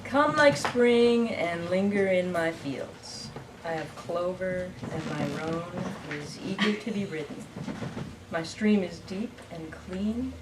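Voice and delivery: unhurried poetry reading with long pauses; poem reading. Acted. Poet reading a poem called For You, measured recital. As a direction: read it as verse, line by line. Reads own poem aloud.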